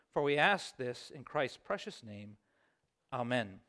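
pastoral prayer. Acted. Pastor praying for his congregation before the sermon. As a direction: pray humbly for the whole church.

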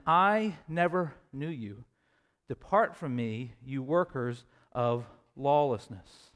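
slow, measured pronouncement, dropping low; solemn rejection. Acted. Preacher quoting Jesus dismissing false followers, solemn and slow. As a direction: pronounce the sentence slowly, sinking in pitch.

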